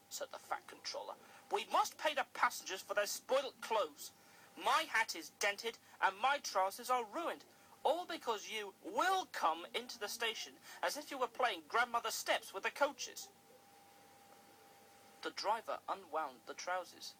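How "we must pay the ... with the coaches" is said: scolding. Acted. Controller scolds Percy.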